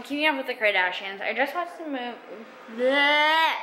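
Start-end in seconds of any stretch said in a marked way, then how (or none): 2.8-3.5 loud, high exasperated bleh; frustrated bleh. Real, not acted. Frustrated by her unfocused camera, a loud bleh. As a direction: spit out a fed-up bleh. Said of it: "I'm getting so frustrated".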